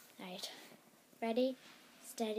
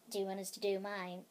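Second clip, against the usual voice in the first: normal talking voice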